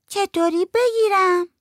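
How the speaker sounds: put-on high, slow childlike question; little girl voice. A narrator's reading. Little girl asks her aunt how to use the phone, high and slow. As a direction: ask slowly in a small girl's high voice.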